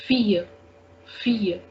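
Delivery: "fear" said fee-yuh, no L; French "ille" sound. Shown as a demonstration. Teacher showing the French for girl, "fear" as fee-yuh. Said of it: "The sound is fear".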